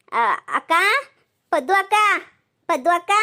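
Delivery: calling out. Acted. Daughter calls out to neighbour Paddu at her door. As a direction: call her name up to the house.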